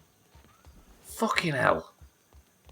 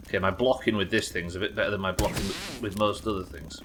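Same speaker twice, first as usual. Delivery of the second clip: ordinary talk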